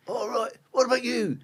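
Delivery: high-pitched inmate passing the question on; prisoner calling out. Retold or imitated. Prisoner asking the next man what he did, calling out.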